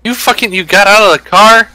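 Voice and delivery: loud, high outraged yell; raging gamer. Real, not acted. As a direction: shout it in disbelief at a teammate. Shocked yelling at teammate.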